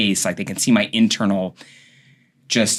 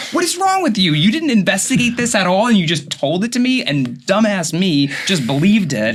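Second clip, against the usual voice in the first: scolding rant; 10 semitones higher than usual. Retold or imitated. Doctor scolding the nurse over the wrong registration, exasperated. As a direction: tear into the nurse in disbelief.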